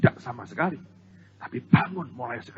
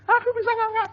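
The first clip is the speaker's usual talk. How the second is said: put-on high, proud little kid's voice; boasting child; 3 dB louder than usual. Child boasting to others, high proud voice. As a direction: brag like a small child.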